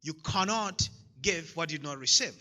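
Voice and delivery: normal talking voice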